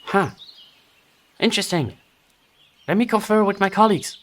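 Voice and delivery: high goblin voice, musing; a goblin weighing its options aloud. Acted. Hidden goblin mulls the answer, high goblin voice.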